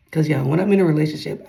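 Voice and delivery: ordinary talk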